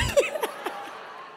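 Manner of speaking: high, teasing laugh. Real, not acted. Speaker teasing colleague about the mic, high laugh. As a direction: laugh at your own tease.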